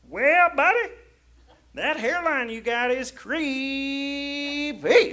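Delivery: high put-on voice, dragging the last word; high, slow mock drawl; imitated stranger. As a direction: mimic the stranger, stretching "creepy" way out.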